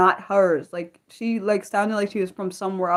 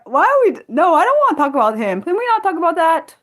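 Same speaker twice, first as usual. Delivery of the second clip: loud protest; 8 semitones higher, 6 dB louder than usual. Real, not acted. Chat raises a politician she avoids.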